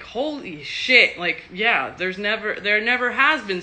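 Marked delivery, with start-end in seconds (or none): 0.0-1.2 drawn out, higher and louder in retold awe; amazed holy shit. Recalling first watching the show, slow, stunned amazement. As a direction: replay the moment the show blew your mind. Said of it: "watching the show and being like".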